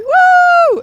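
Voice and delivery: high, loud whoop; party whoop. Real, not acted. Celebrating the night on the boat, a loud whoop. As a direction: let out a wild party cheer.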